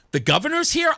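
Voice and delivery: high-pitched retold surprise; taken aback; surprised question. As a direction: ask it high, caught off guard. His surprised reply, retold.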